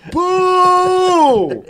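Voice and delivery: high, loud booing cry; mock booing. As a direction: boo him off loudly. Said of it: "I'm like".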